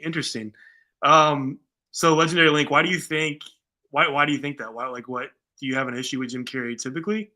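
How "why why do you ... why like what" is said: ordinary talk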